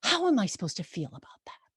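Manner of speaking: whispered, fast, bewildered; hushed question. Retold or imitated. Tourist whispering her doubt to the ranger. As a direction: breathe it out, lost. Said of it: "she goes".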